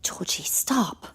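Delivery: hissed whisper, slowed; urgent whisper. Acted. Sister urgently hushes her brother in a whisper. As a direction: hush him without the lawyer hearing.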